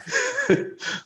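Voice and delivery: squealing high laugh; high burst of laughter. Real, not acted. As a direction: crack up at the accent. Laughs at Welsh accent.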